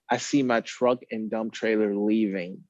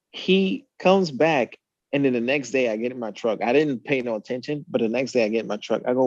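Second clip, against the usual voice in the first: normal talking voice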